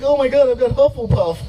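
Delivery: high mock-panicked gasp; lightly voiced alarmed client; panicked customer. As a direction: squeal it like a horrified homeowner. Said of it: "They were like".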